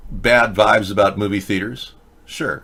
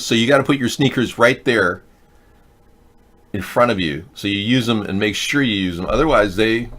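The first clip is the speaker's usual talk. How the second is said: normal talking voice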